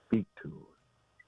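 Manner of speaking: slow, whispered urging; low whispered instruction; hushed prompt. Real, not acted. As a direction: whisper it slowly to the congregation. Preacher drops voice for emphasis.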